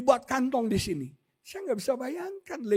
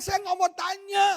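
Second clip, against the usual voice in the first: louder, rising preacher's declaration, ending half-sung; full-force preaching; 5 semitones higher, 6 dB louder than usual. Acted. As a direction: proclaim it over the music, lifting into song. Preaching climax over music.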